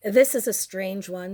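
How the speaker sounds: normal talking voice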